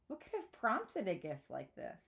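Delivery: plain reading voice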